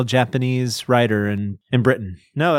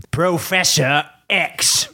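slow, higher mock British; British accent; 6 semitones higher, much slower than usual. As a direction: draw it out in a put-on British accent. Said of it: "Uh, British".

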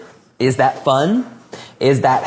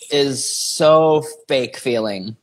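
slow emphasis; 3 semitones lower, much slower than usual. Acted. Slowed emphasis.

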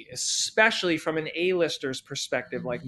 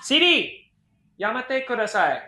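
high, raised call to a voice assistant; calling out a command; 6 semitones higher, 3 dB louder than usual. Real, not acted. Speaker telling a misfiring Siri to stop, calling out.

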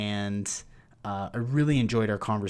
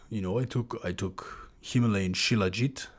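put-on Estonian accent. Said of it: "He's got this cool Estonian accent".

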